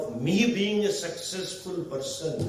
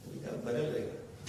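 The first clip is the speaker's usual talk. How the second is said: much softer acted mother; soft motherly reply; 11 dB quieter than usual. Mother confirms the dish to her son, softly. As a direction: answer the child quietly and gently.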